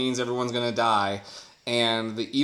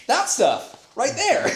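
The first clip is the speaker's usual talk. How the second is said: excited; 11 semitones higher, 4 dB louder, slower than usual. Pretend. Gamer senses voicing loot.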